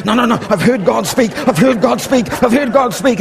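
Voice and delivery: loud, high, insistent repetition; insistent excitement. Retold or imitated. Shaking off his friend's grip, loud insistent conviction. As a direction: brush him off with fierce conviction. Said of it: "I shake his arm off and go".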